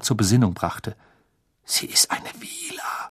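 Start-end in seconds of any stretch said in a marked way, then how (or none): 1.6-3.1 hoarse, breathless whisper. A narrator's reading. Ron to Harry, stunned by the girl, hoarse whisper. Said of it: "mit heiserer Stimme hervor".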